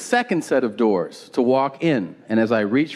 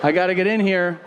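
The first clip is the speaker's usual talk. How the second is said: nervous brush-off; 6 semitones higher than usual. Retold or imitated. Nervously escaping the guard.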